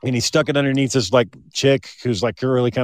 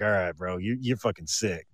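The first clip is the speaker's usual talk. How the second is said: disgusted mutter; 6 semitones lower, 10 dB quieter than usual. Retold or imitated. Retold disgusted remark.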